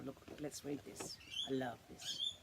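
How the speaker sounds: ordinary delivery, nothing marked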